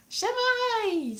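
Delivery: very high, stretched greeting; mock-Welsh dragon. Comic voicing the dragon, high mock Welsh. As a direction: call it out in bad Welsh. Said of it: "said the dragon".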